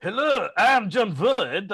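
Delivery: mock movie accent, high, loud and slow; imitating a silly film villain accent; Jon Voight's accent. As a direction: ham up a bad foreign movie accent. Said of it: "nothing's gonna top Jon Voight's accent in that".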